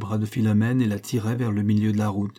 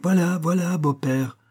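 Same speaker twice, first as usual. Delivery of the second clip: high, teasing singsong reply; sniggering; 7 semitones higher than usual. A narrator's reading. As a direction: placate him mockingly, pitched up. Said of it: "ricanait-il". (réponse narquoise, voix plus aiguë)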